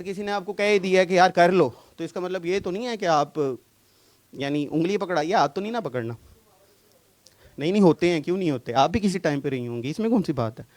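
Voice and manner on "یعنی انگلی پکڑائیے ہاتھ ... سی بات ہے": ordinary talk, nothing marked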